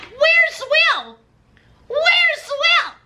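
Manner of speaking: high mock yell; mock shouting Courtney. Courtney demanding her husband at the jail, high mock shout. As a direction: shriek it like a spoiled wife. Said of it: "and she's like".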